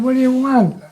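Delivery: loud, high-pitched comeback; exasperated protest. Acted. Acted protest back at the official.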